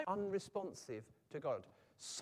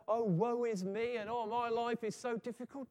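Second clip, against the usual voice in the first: high, put-on self-pity; mock pitiful; 5 semitones higher, 4 dB louder than usual. People feeling pitiful, moaning in mock self-pity. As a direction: exaggerate the woe-is-me complaint. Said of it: "pitiful and all".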